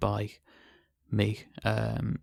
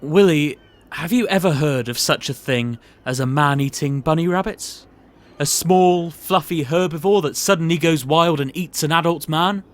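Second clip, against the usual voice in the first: looming, menacing posh boss; sinister boss; 8 semitones higher, 7 dB louder than usual. Acted. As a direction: build dread as you reveal the secret. Boss reveals the dark secret.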